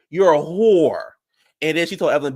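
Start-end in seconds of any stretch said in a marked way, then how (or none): 0.1-1.1 slow, higher, stretched-out repeat; slow drawn-out insult. Retold or imitated. Jackie repeating the insult to Evelyn, slow and drawn out. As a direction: drag every word out. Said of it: "She was like".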